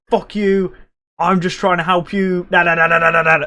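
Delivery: high-pitched ranting imitation, no shouting; mock tirade. Brother turns on his friend, high mock tirade. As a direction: mimic a rant running on into blather. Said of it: "still on this absolute tirade".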